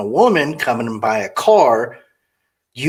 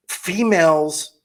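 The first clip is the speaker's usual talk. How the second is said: normal talking voice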